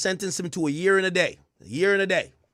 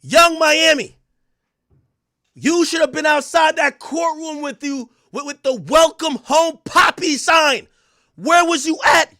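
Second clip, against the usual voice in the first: much higher, louder call-out; 9 semitones higher, 8 dB louder than usual. Real, not acted. Streamer hollering at [name] for missing the courtroom. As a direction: holler at her across the room.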